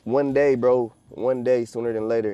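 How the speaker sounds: loud fired-up vow; hyped up. Real, not acted. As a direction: shout it like a promise. Motivational hype to viewers.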